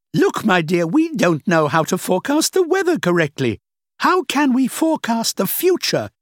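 a hint of a dismissive older woman; lightly played older woman, amused. The woman lectures Rachel.

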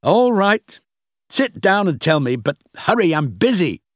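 high, grudging, irritated voice; impatient. A narrator's reading. Busy boss letting Rachel explain, impatient. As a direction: give in crossly and hurry her along.

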